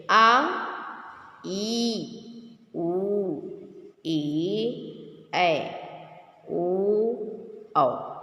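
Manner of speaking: आ ई उ ए ऐ ओ औ each drawn out long; long Hindi vowels, slow and sustained; long vowel demo. Shown as a demonstration. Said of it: "इनकी अपेक्षा अधिक लगा ना समय".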